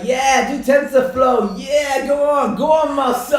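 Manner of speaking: high, very loud imitation of a cheering viewer; mock crowd shout. Viewer egging on the streamer to try TensorFlow, imitated shouting. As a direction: yell it out like an egging-on heckler. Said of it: "Someone just shouted out".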